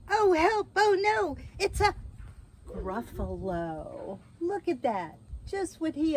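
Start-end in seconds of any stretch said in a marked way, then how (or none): 0.1-1.9 high, loud storybook panic; acted alarm, pitched high and loud; alarmed cry. Mouse meets the real Gruffalo. 2.7-4.2 slow, lower naming of the monster; hushed-down, lingering storybook reveal; drawn-out reveal. Acted.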